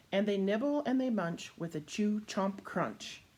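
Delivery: lively, rhythmic read-aloud; reading to children. A narrator's reading. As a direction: bounce the rhymes for little listeners. Reading picture book to children.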